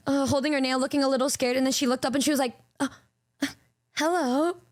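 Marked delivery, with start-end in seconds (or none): 2.8-4.6 shy flirty hello. Retold or imitated. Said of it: "Her whole demeanor fucking changed".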